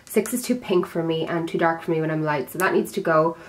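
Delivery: ordinary talk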